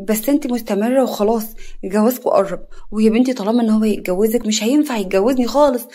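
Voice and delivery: plain reading voice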